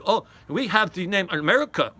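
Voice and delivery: mock German humanist boasting in a clumsy German accent; bad German accent. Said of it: "that wasn't a German accent".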